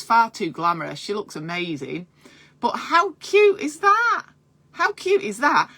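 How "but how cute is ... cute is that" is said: high, slow, delighted gush. Real, not acted. Midwife gushing to viewers over a photo, high and delighted. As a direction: melt over how cute it is.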